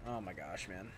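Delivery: low soft fed-up groan; exasperated mutter. Real, not acted. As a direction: mutter it under your breath.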